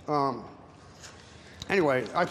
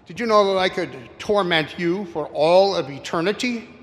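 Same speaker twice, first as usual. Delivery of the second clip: getting angry; 3 semitones higher, 8 dB louder than usual. Acted. Satan, getting angry, threatens eternal torment in a raised voice. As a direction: let the anger rise as you threaten. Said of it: "Satan's getting a little angry".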